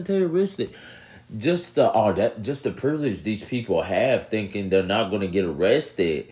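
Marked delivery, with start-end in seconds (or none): none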